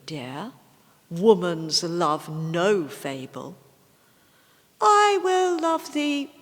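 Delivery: recited poetry voice, set apart from the chat; poem reading. Acted. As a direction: read it aloud as a recited love poem. Reads a love poem aloud.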